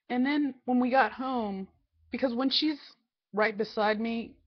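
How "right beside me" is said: ordinary delivery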